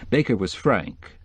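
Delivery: usual speaking voice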